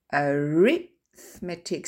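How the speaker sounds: slow arithmetic, stress on RITH. Shown as a demonstration. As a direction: hit RITH in arithmetic, the second syllable. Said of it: "l'accent tonique est sur le deuxième wagon". (accent tonique sur RITH dans arithmetic)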